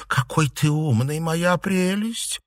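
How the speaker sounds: slow, high syrupy coo; cooing flattery. Acted. Girl flattering a boy to annoy her rival, cooing. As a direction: draw it out adoringly.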